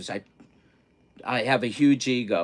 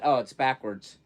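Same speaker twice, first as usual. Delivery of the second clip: normal talking voice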